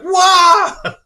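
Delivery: high, loud startled cry; startled whoa. Retold or imitated. Man behind her on subway stairs startled, loud high whoa. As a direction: jump back at the sight of a rat.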